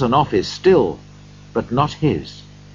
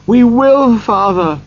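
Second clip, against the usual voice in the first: high, loud eager cry; children crying out; 12 semitones higher, 7 dB louder, slower than usual. A narrator's reading. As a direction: shout it together like children. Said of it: "they all cried again".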